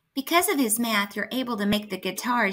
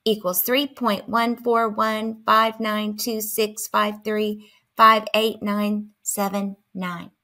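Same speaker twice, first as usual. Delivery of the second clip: slow digit recital; slower than usual. Shown as a demonstration. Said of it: "Like this".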